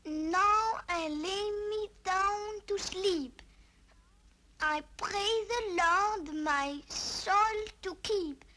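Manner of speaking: slow, measured recitation; drawn-out bedtime prayer in careful English; careful learned prayer. Acted. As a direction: recite a newly learned prayer word by word, slowly. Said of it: "I've been learning that one".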